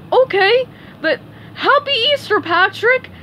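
high, loud cartoon parroting; Patrick repeating. A narrator's reading. Patrick repeats SpongeBob's greeting word for word, parroting. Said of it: "repeated Patrick".

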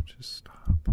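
sigh, then whisper it; begging it to stop, under your breath; weary whisper. Acted. Whispering plea to inner voice.

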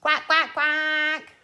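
loud, evenly spaced duck quacks. Pretend. Leader quacking for the children, loud duck noises.

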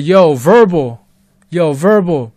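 loud, slow retold hail; calling out. Friends calling the narrator by phone, loud and drawn out. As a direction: imitate friends calling a name down the line.

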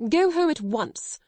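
put-on high, loud order to the girl; indignant command. A narrator's reading. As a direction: send the child home with outrage. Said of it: "she commanded indignantly".

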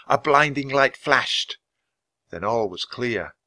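plain reading voice

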